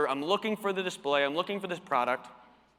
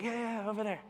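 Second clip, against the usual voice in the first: lightly voiced, higher and softer offhand brush-off; dismissive store owner; 6 semitones higher, 6 dB quieter, faster than usual. Store owner brushing off the salesman, lightly mocked dismissive voice. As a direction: wave him off like a busy boss.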